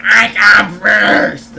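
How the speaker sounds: loud, drawn-out mock shout; hammed-up self-important holler; mock old lady. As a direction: yell it slowly as the pushy old lady. Old lady snaps back, retold.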